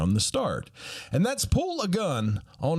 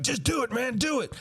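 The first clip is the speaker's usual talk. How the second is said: high mock shout to go on; urging shout; 11 semitones higher than usual. Pranksters push Murr toward the liquor store, high urging. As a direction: push your buddy to do it.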